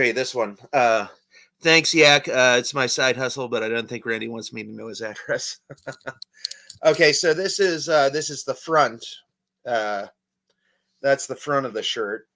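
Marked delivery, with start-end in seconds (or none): 5.6-6.8 short, soft laugh to himself; chuckle. Real, not acted. Chuckles at his own joke.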